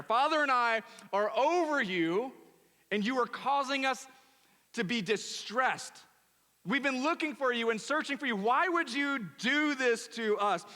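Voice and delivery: high, loud mother claiming her due honor; Mary rebuking Jesus for the search, high and loud; offended parent. Acted. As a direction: scold like a wounded mother. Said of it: "In our culture".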